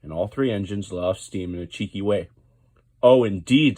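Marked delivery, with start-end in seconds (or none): 3.0-3.8 much louder, stern and severe; a boss about to lay down the law. A narrator's reading. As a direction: severe Oh indeed. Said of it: "said the Fat Controller severely".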